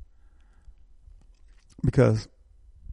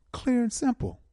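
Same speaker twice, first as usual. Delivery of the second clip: "Clear" hit much higher, then falling; emphatic; 12 semitones higher than usual. Real, not acted. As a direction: ring out "Clear and simple" high on Clear. Host repeats his slogan emphatically.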